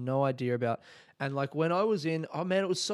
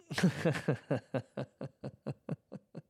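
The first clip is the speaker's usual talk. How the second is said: real laugh after a yawn; 5 dB quieter than usual. Real, not acted. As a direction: burst out laughing.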